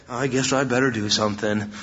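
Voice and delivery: low, grudging mock guilt; guilty reluctance. Preacher mimicking a guilt-prodded churchgoer, reluctant. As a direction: sink low, imitating a guilt-prodded volunteer. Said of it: "they start feeling really bad".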